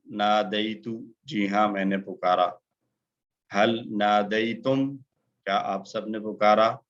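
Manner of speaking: usual speaking voice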